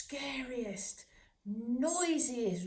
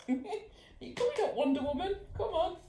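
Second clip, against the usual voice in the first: much higher, laughing call to the dog; 9 semitones higher, 3 dB louder than usual. Real, not acted. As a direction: coax her up with a giggle. Calling a costumed dog upstairs.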